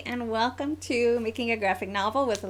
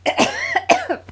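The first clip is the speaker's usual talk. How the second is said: coughing; 10 dB louder than usual. Real, not acted. Coughing fit before she can speak.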